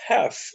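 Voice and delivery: "half" with a short open vowel, "haf"; crisp model of "half" as "haf", pitched high; correct "half". Shown as a demonstration. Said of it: "Você vai pronunciar ela".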